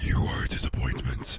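deep whispered demon father; pit fiend. Pretend. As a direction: growl it low like a devil.

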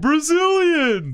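very high, slow and loud; high drawn-out. Acted. Joke answer, drawn out high.

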